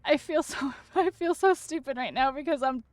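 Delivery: high, strained, overwhelmed near-crying; fighting back tears. Real, not acted. Streamer overwhelmed by viewers' gifts, trying not to cry. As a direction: protest the kindness while holding back real tears. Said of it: "I'm trying so hard not to cry".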